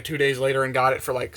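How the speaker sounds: normal talking voice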